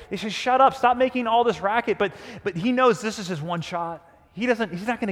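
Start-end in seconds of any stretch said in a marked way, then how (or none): 0.3-2.0 louder, higher mock scolding; annoyed crowd. Annoyed crowd telling the beggar to stop, mock scolding. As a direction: imitate a crowd shouting someone down. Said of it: "the crowd is annoyed with this guy".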